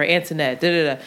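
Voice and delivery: flat, brushing-off self-introduction; dismissive. Retold or imitated. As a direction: toss your name off like you don't care. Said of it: "just dismissive or whatever".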